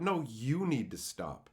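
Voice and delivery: normal talking voice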